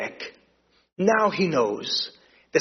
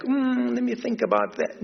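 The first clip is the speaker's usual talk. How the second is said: higher, hammed-up hesitation; mock stalling reply, pitched up; mock dithering; 7 semitones higher than usual. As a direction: play a lazy servant stalling. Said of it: "they're like".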